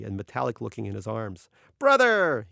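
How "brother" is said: high, loud greeting call; excited shouted greeting; hearty shout. A narrator's reading. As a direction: hail a friend across the room. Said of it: "he shouted".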